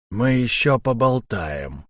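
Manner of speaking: slow, drawn-out menace; understated, slow parting threat; grudging threat. A narrator's reading. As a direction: let the threat out slowly. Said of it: "процедил штурмовик".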